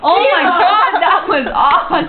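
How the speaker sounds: high, giggly through laughter; laughing. Real, not acted. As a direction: squeal it out between giggles. Laughing at the burping.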